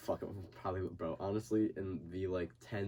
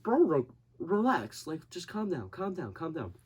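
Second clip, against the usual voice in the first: mock calming; 8 semitones higher, 4 dB louder than usual. Calming the scared friend.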